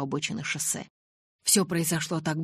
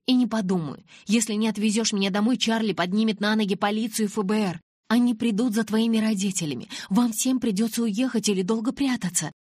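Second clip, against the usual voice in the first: higher and louder furious threat; 6 semitones higher, 4 dB louder than usual. A narrator's reading. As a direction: threaten them, shaking with anger. Bella threatens in fury. (гневная угроза, выше и громче)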